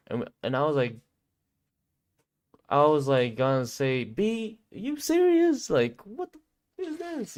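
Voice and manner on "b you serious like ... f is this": high-pitched squeal of disbelief; incredulous. Retold or imitated. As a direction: squeak it out, sky-high, in mock outrage. Acted disbelief at the spot.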